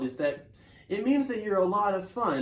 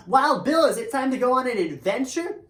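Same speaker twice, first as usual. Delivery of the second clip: much higher, louder horse voice calling his friend awake; eager horse calling; 7 semitones higher, 6 dB louder than usual. Acted. Tempo the horse shouts to wake sleeping Wild Bill, high eager call. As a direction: bounce in, shouting him awake. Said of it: "you can know that Tempo is talking".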